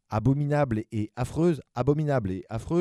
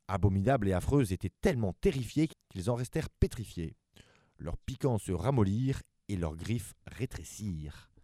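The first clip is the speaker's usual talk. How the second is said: ordinary delivery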